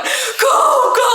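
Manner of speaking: high, loud urgent command; mock frantic shout. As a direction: yell at a driver to floor it. Yelling at Uber driver.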